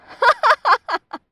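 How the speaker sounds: high, loud staccato cackle; crazed laugh, acted. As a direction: burst into hysterical laughter. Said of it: "Me reía".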